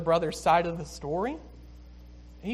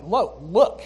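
disrespectful; 2 semitones lower, 8 dB louder than usual. Retold or imitated. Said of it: "feel the disrespect coming from him".

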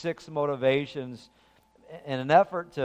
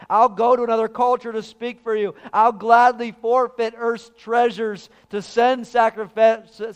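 raised, eager acted plea; fervent pleading preaching; 7 semitones higher, 10 dB louder than usual. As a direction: plead to be sent, volunteering wholeheartedly. Acts a fervent plea to God.

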